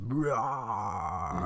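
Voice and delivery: slow, drawn-out rasping cough noise; imitated rough cough. Host imitating his own aggressive cough with a drawn-out rah. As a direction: growl out a rah like a hacking cough. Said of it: "it was just like".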